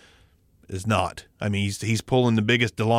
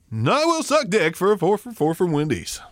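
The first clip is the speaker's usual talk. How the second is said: higher-pitched mock radio announcer; 7 semitones higher than usual. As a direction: belt it out like a hammy announcer. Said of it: "amazing radio voice".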